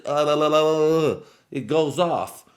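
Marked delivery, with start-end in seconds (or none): 0.1-1.2 tongue-tripping babble, higher and louder; mock garbled speech; tongue-tied babble. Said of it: "once my tongue gets to".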